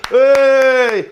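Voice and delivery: big high loud yell of approval; cheering shout. Acted. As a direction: bellow it like a cheer from the cabin. Irishman cheering the pilot's landing.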